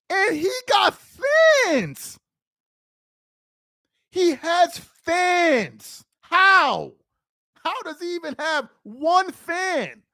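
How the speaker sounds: high-pitched, drawn-out disbelief; slow, exasperated amazement; incredulous. Real, not acted. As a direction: stretch each phrase slowly, pitched high in bafflement. Disbelief that LeBron has fans.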